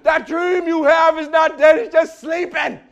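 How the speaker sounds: loud, high-pitched proclaiming cry; thunderous preacher proclamation; big church preacher shout. Pretend. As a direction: belt it out like a packed megachurch moment. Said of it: "You watch what happens when you say that at T D Jakes' church".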